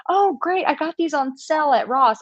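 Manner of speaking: high-pitched mock enthusiasm; hammed-up, high, excited chatter; over-sharing American. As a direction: imitate a woman delighted by a compliment and oversharing. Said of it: "American women go a little too far".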